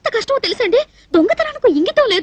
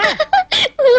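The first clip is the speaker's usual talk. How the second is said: high-pitched giggle; 8 semitones higher than usual. Acted. Woman amused by her visitor's remark, giggling. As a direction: burst into a rising laugh. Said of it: "ఏమిటా నవ్వు".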